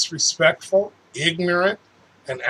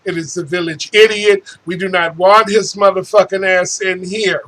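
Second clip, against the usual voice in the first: ordinary talk, nothing marked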